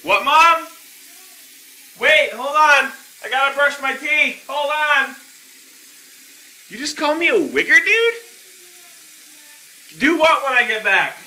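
put-on outraged holler; indignant calling out. Acting indignant, yelling over to mom.

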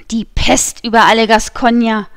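a changed voice spitting out a curse; cursing. A narrator's reading. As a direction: curse the whole lot of them. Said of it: "fluchte er".

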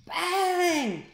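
loud high crash noise; bang. A narrator's reading. Narrator bursting out a loud bang. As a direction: belt out the crash like a cartoon. Sound effect in read-aloud story.

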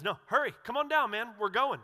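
Jesus calling up to Zacchaeus in the tree, eager and loud. Retold or imitated. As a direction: call him down from the tree, urging.

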